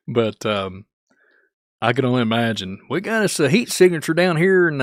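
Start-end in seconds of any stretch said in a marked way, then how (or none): 2.9-4.8 high, loud hammed-up Kentucky hillbilly voice, laughing through it; hillbilly local accent. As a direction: shout it like an excited Kentucky local. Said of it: "full-blown hillbilly".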